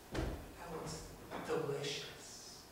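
hungry longing. Acted. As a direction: murmur it like a starving man. Starving son eyes pig slop.

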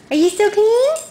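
high sing-song coo at a pet. Real, not acted. Cooing at dog after bath.

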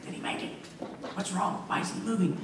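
hushed, acted whispers passed back and forth; whispering crowd. Crowd murmurs over the boy lying still, whispering. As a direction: whisper like puzzled onlookers. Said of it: "is whispering back and forth".

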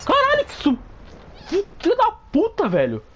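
very high squealing shout; startled shriek. Real, not acted. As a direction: shriek in fright. Jump-scared by enemy.

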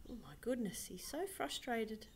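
hushed whispered aside. Real, not acted. Narrator whispering to the audience, hushed. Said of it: "whispered aside".

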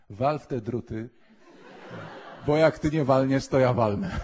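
loud, laughing dare; laughing challenge. Retold or imitated. A cheerful boy with Down syndrome dares the cleric, loud and laughing. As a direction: bark it out through laughter. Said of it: "mówi do mnie".

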